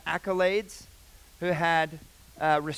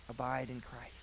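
whisper; 3 semitones lower, 9 dB quieter than usual. Real, not acted. Whispered exhortation in sermon.

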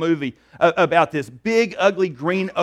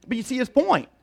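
normal talking voice

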